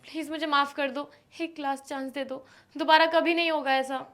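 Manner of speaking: wife begs husband not to walk out; pleading softly. Acted. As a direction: beg him not to leave. Wife begs him to stay.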